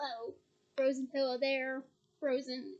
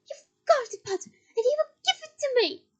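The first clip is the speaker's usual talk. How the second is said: much higher, louder frantic scream; 11 semitones higher, 10 dB louder than usual. Acted. Bellatrix screaming at Harry, terrified and furious. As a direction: scream it in desperate rage.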